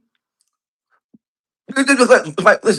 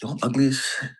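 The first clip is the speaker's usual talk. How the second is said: low, softer retold self-disgust; quiet dismay; 4 semitones lower, 9 dB quieter than usual. Retelling his look in the prison mirror, quiet dismay. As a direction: mutter it low, as if to yourself.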